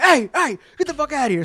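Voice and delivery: retold high-pitched yell at a dog; alarmed yelling.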